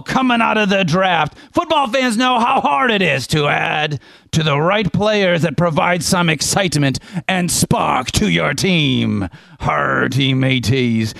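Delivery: Captain Squirrelbeard. Acted. Pirate captain hailing his mateys with a rum pitch, hearty pirate voice. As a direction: ham it up as a salty sea captain. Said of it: "fun to do the pirate voice".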